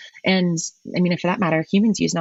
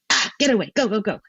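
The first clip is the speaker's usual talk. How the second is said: a mock aggressive "get away", pushed a bit higher; aggressive shooing; 5 semitones higher, faster than usual. Shown as a demonstration. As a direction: shoo someone off like a threatened animal. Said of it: "my voice gets low and, um, louder".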